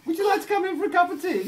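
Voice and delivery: very high, loud, quick posh invitation; Maggie Thatcher accent. Acted. Posh lady inviting her visitor in for tea, shrill posh accent. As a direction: trill the invitation in an upper-class accent. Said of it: "posh sort of Maggie Thatcher accent".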